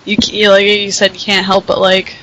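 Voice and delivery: ordinary talk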